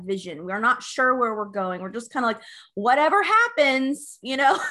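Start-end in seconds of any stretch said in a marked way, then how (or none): 2.8-4.2 drawn-out, higher and louder mock shrug; breezy go-with-the-flow imitation, slowed down; mock carefree. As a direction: act out not caring, loud and slow. Mimicking people living without vision.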